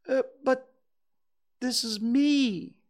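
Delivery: high, slow, puzzled bear; baffled protest, pitched high and drawn out; very surprised. A narrator's reading. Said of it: "very much surprised".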